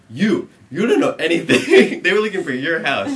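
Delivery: loud, high, emphatic cop voice; mock interrogation. Cop singling out one boy, loud insistent questioning. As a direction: press the suspect loudly, half laughing.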